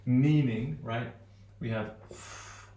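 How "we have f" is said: "f" hissed out, unvoiced; the f sound alone. Shown as a demonstration. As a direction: blow a long "f". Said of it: "here I'm doing the sounds".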